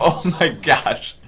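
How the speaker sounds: slow laughing oh my gosh; laughing amazement. Real, not acted. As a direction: laugh in disbelief.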